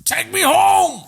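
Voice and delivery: very high, stretched plea; hammed-up high wail; helpless disguise character. Wheelchair character answering a helper, mock helpless cry.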